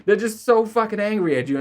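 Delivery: laughing while talking. Real, not acted. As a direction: giggle through it. Laughing at own story.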